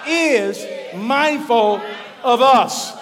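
very loud, slow, word by word; leading the response. Shown as a demonstration. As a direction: call the congregation to say it with you. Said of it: "Would you say that with me?".

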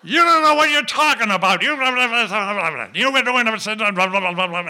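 high, loud mock tirade; yelling priest. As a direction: yell a scolding that dissolves into blah-blah gibberish. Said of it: "And he yells at me".

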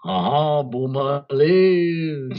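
slow, loud mock French Boomerlin; hammed-up French accent on Boomerlin. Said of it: "do it in a French voice".

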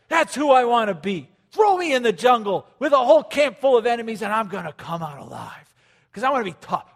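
hammed-up wannabe action hero; mock tough-guy wannabe. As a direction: puff up like a kid wanting to be Rambo. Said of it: "Check this out".

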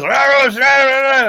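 high, loud mock gibberish; foreign babble imitation. The Hungarian host toasting with his drunk guest, loud high babble. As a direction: cheer in made-up Hungarian-ish noise. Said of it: "and he goes".

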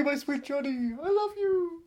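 high, soft lovesick sigh; mock swooning. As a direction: swoon over him theatrically. Mock swooning over Johnny.

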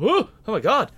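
loud, high whoop of disbelief; overwhelmed gasp. Retold or imitated. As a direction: re-enact being bowled over, voice leaping up. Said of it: "that was a moment of".

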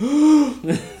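high sharp gasp of alarm; loud startled gasp; shocked gasp. Retold or imitated. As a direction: suck in air at the risky move. Gasp at wrestler's move.

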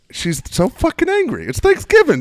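high, loud and fast ranting; exasperated rant. Retold or imitated. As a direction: son venting about his angry mother, exasperated shout. Speaker's own exasperated rant.

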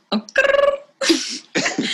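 high playful grrr on request; a short silly growl for the room. Shown as a demonstration. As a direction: growl it out like a cartoon beast. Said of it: "[name], do that sound, please".

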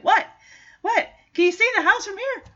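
fast, high baffled questions; a young dog not catching up; confused dog voice. Retold or imitated. Chance's confused quote.

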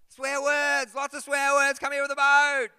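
loud, high panicked shout; mate bellowing from the surface; frantic yelling. Retold or imitated. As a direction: scream for the boat to come. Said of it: "My mate's on the surface going".

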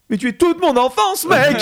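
high, loud fan yell; starstruck outburst. Retold or imitated. Fan meeting the singer of his childhood theme, starstruck outburst.

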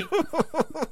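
shrieking laugh. Acted. Mid-rant laugh.

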